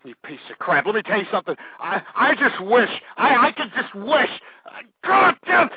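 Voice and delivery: ordinary delivery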